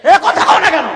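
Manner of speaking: sudden high shout at the crowd; loud challenge to the congregation; demanding shout. Real, not acted. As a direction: burst out loudly, demanding an answer. Preacher demands response from crowd.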